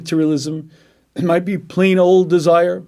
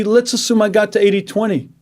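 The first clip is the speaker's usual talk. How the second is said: ordinary talk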